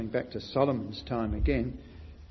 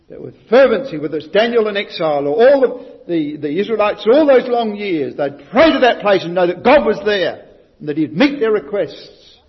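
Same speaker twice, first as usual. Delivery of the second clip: loud, raised preaching voice; fervent preaching; 6 semitones higher, 11 dB louder than usual. Real, not acted. Preacher praising Israel's prayer, loud fervent preaching. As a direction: build it with fervour.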